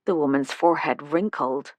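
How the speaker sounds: plain reading voice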